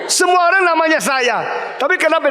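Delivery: preacher shouting the point to the congregation; preaching shout. Real, not acted. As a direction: call it out to the hall.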